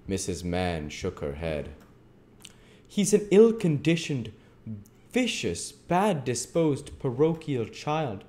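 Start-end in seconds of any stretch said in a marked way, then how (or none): 2.9-8.2 high, loud, indignant character voice; angry beadle. A narrator's reading. Bumble condemning the child to Missus Mann, angrily. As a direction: spit out each charge at a raised pitch. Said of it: "said Mister Bumble angrily".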